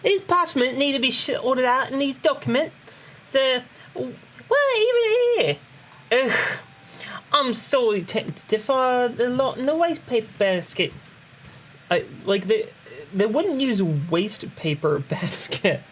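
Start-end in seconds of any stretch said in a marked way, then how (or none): 0.0-10.9 higher-pitched Cockney character voice; Cockney accent. Acted. Player voices Tataru's lines for a friend, Cockney accent. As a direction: put on a Cockney lilt, pitched up. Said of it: "you want me to do it all Cockney?".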